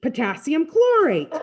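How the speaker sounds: high, loud call; excited, high-pitched yell; exultant shout. A narrator's reading. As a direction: shout the name at the sky. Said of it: "Freak keeps on shouting out the names".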